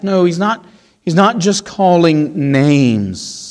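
ordinary talk, nothing marked

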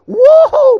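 high, loud delighted whoa; a big excited whoa. Real, not acted. Preacher celebrating how his joke broke the ice, loud whoa.

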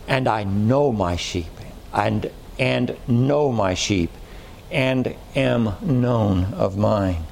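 low, slow reading leaning on "and"; stress on "and". Shown as a demonstration. Preacher reading the verse to the congregation, stress on "and". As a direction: set "and" apart with deliberate pauses. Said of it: "notice the emphasis on the word".

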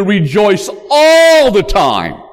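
ALL drawn out high and loud; stress on ALL. Shown as a demonstration. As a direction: lean long and hard on "all" in "all the time". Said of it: "There's the emphasis".

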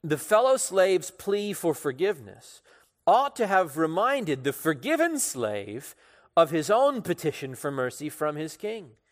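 ordinary delivery

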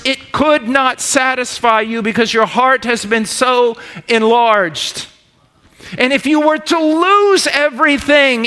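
loud, high preaching, slow and swelling; preaching at full force. Acted. Preacher building to the crowd, loud and high. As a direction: preach it out to the congregation.

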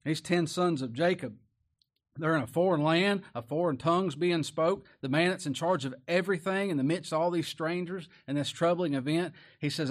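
usual speaking voice

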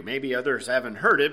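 ordinary talk, nothing marked

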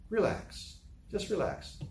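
soft, hushed reassurance; calm a frightened friend gently; hushed, soothing words to settle the worry. Acted. Calming a worried crowd member.

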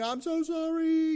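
high, slow mock sobbing apology; mock blubbering. Someone who wronged you blubbers an apology, mock sobbing. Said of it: "crying and blubbering and, and slobbering".

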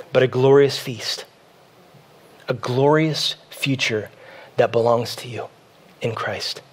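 soft, low preaching with long pauses; hushed sermon close. Real, not acted. Pastor assuring congregation of a glorious future, hushed preaching. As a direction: bring it down quietly, phrase by phrase.

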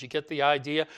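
ordinary talk

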